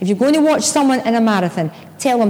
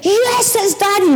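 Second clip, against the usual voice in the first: high, jubilant cry of recognition; re-enacted finish-line yell; retold screaming; 8 semitones higher, slower than usual. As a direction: burst out with joy, pitched way up. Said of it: "we're there screaming our heads off".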